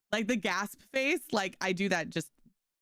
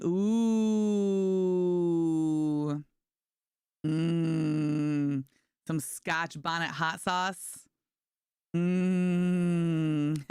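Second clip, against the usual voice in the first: slow appreciative hum; savoring; 3 semitones lower, much slower than usual. Real, not acted. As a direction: relish the taste. Savoring food memory.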